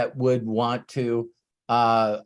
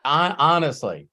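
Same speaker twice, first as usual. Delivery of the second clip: ordinary talk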